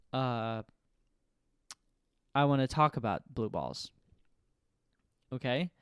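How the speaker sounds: usual speaking voice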